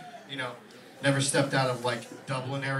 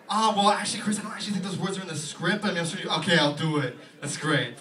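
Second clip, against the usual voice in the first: loud, higher, flustered agent caving; 4 semitones higher, 6 dB louder than usual. Retold or imitated. Agent caving to Chris, loud and flustered. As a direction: give in mid-sentence. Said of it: "his agent".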